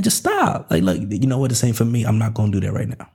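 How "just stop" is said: a sudden leap up high on "stop"; emphatic command. Real, not acted. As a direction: cut them off, pitch flying up. Preacher urging listeners to stop.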